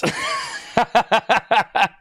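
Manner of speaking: high, rapid burst of laughter; real laugh. Real, not acted. Streamer tickled by her own Waffle House joke, high laughing. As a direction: crack up at your own joke.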